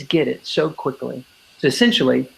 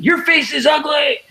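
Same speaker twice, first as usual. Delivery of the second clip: mean shout; 11 semitones higher than usual. Retold or imitated. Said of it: "they'll get really mean".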